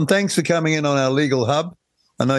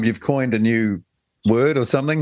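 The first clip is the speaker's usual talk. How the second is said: normal talking voice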